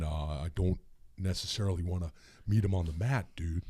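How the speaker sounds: low, mumbled, calm wrestler voice; low calm Hulk Hogan. Shown as a demonstration. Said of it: "that's how Hulk Hogan would talk".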